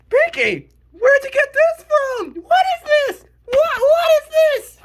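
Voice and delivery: incredulous squeak of confusion; can't believe what you're seeing; baffled disbelief. Real, not acted. Baffled questioning of a character.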